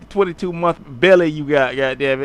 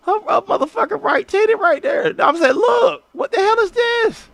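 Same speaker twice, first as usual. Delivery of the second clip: much higher, hammed-up shrill yell; mock high shout. Retelling what he told Kiki, in a high mock shout. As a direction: shriek it like you just caught her. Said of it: "I say, I say".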